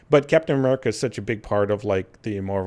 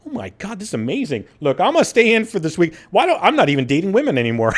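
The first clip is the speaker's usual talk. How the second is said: mock excited; 7 semitones higher, 4 dB louder, faster than usual. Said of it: "he was like".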